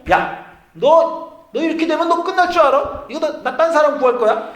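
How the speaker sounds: loud, high, furious scolding. Acted. Pastor voicing God scolding Abraham, loud and furious. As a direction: tear into him like an angry boss. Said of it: "지금은 꾸짖고".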